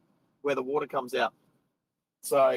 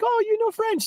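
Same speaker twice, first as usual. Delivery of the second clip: much higher, mock surprised girl's voice; imitating the French girl. Retelling the French cashier's question, high mock surprise. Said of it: "And she's like".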